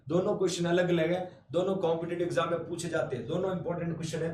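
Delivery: normal talking voice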